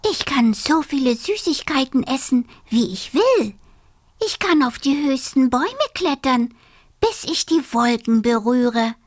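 fast, high and eager; excited dormouse. Acted. Dormouse dreams of his wakeful months, fast and high. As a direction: rattle off the dreams with glee.

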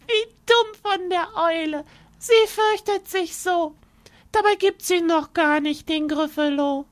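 a tiny mouse laughing at the fooled fox; gloating mouse. Acted.

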